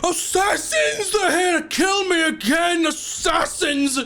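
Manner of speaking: panicked high shouting; shaking with anger. Acted. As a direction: scream it in terror, high and slow. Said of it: "his eyes get wide, and he goes".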